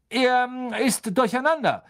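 high, excited call; Lupin. A narrator's reading. Teacher to class about the confused boggart, calling out. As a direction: call it out over the commotion. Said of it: "rief Lupin".

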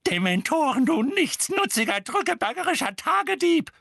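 high, loud scolding; furious screaming. A narrator's reading. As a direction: hurl insults in a high-pitched shriek. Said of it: "schrie Misses Figg".

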